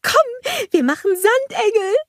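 high, joyful cry of a snowman; exulting. A narrator's reading. Olaf urges Anna to play.

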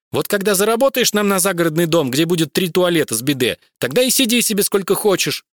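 high, loud nagging wife voice. Acted. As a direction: scold him through the door. Wife nagging through door.

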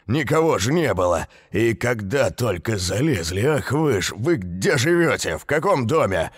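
high, hammered interrogating yell. A narrator's reading. Builder interrogating caught children, yelling high and hard. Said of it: "Продолжал он вопить".

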